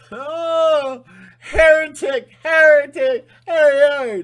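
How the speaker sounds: very high, loud shriek of heretic; mock shrieking zealot. Mocking old-time zealots accusing a heretic, shrieking. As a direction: screech like an outraged zealot.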